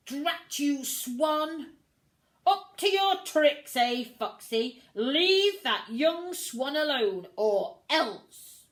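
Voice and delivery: loud, scolding swan voice; angry swan. Acted. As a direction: tell that fox off sharply.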